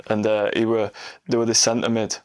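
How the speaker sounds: usual speaking voice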